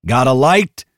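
high shouted prompt; calling out. A narrator's reading. Hicks yelling to flamethrower bearer.

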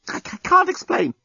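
very high, stammered protest; flustered stammer. A narrator's reading. Journalist cornered by Lucy's questions, flustered. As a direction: stumble over it, cornered.

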